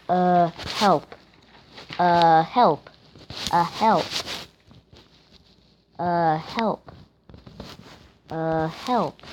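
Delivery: low drawn "Uh", then a separate "help"; mocking hesitant help. Gamer mimicking the game's cry, deadpan "Uh, help". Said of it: "who says, uh, before they say help".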